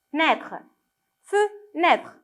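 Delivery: "fenêtre" split as fe, pause, nêtre; syllable-by-syllable reading. Shown as a demonstration. Teacher has children spot a letter, "fenêtre" split slowly into chunks. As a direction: sound out "fenêtre" in two separate chunks. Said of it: "Essayez de penser très, très bien".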